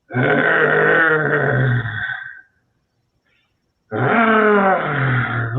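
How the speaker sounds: imitating an angry growl, like a wild animal about to pounce; wild animal snarl. Arnold snarling at the publicist asking for an autograph, mock animal growl. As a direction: growl it out like a beast ready to kill. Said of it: "literally like a wild animal".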